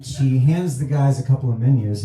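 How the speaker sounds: ordinary talk, nothing marked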